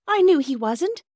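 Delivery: very high, loud exclamation; overwhelmed relief. A narrator's reading. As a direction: burst out in relief. Phyllis relieved, about to cry.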